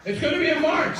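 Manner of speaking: high, loud repeat of a correction; mock echo. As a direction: parrot the producer for the crowd. Loudly relays producer's correction.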